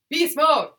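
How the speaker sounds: loud, high hype yell; hype shout. Pretend. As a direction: shout it like a gym bro. Hyping the Pitta body type.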